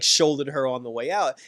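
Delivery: ordinary talk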